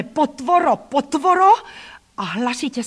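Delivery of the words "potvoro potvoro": high shout, louder on the repeat; shrill-pitched angry name-calling; yelled insult. A narrator's reading. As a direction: scream the insult at the child. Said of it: "ale křikl na mne".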